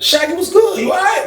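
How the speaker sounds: greeting his friend Shaggy in a high, eager voice; upbeat greeting. Retold or imitated. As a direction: call out a cheerful how-you-doing. Greeting Shaggy, voicing himself.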